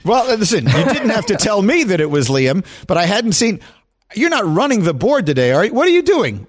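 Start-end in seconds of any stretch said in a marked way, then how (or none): 4.1-6.5 surprised. Real, not acted. Surprised at who runs board.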